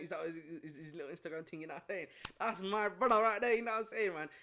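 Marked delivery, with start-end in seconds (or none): 2.4-4.3 hyped shout. Real, not acted. Hyping up a favourite player.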